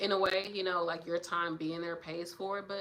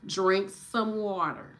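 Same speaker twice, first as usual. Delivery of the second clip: slow, firm drink some water; slow firm repeat; 4 dB louder, much slower than usual. Retold or imitated. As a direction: spell it out slowly to the kid. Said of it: "I'm like".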